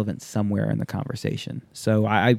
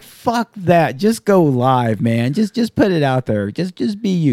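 louder push to just go live; louder urging; 6 semitones higher, 5 dB louder, slower than usual. Retold or imitated. Telling Waz to skip the rehearsal, louder urging. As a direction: urge him on loudly. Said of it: "I'm like".

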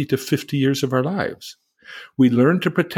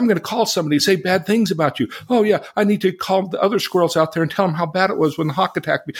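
much higher, fast, indignant outburst; angry animal response; 9 semitones higher, faster than usual. Shown as a demonstration. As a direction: huff and bristle like a cornered animal. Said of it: "the temporal, the animal".

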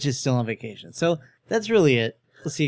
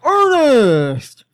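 loud, long high call; shout; 10 semitones higher, 8 dB louder than usual. Acted. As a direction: call out his name across the store.

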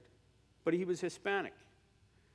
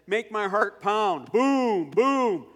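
slow, loud booming words; pounding heartbeat; 8 semitones higher, 13 dB louder, much slower than usual. Retold or imitated. Scene: preacher asking God for a pounding heart, loud slow booms. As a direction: drum out each boom.

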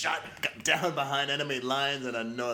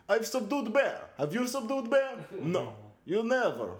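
higher mock Russian strongman taunt; barbarian; 10 semitones higher, 4 dB louder than usual. Putin taunt bit.

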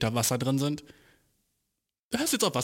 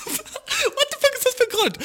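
much higher and louder, laughing out the question; laughing outraged disbelief; 5 dB louder than usual. Real, not acted. Mocking the dude's reason, laughing disbelief.

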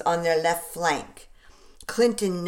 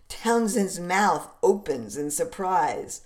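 ordinary delivery

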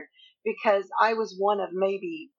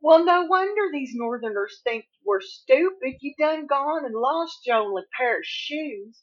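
put-on Southern accent; 6 semitones higher, 3 dB louder than usual. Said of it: "And she said".

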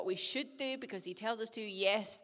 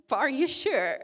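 pushy youth leader; 5 semitones higher, 8 dB louder than usual. Retold or imitated. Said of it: "he said".

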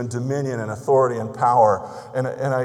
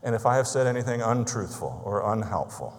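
soft, lower, earnest prayer voice; pastor's hushed closing prayer; 4 semitones lower, 6 dB quieter than usual. Acted. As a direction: pray over the congregation quietly.

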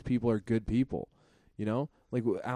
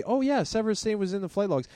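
mock normal voice; 7 semitones higher than usual. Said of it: "switch to normal voice".

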